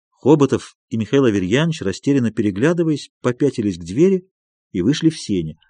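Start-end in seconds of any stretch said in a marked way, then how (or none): none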